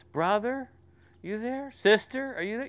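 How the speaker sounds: put-on a child checking that mommy is near; little girl's voice. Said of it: "this little voice".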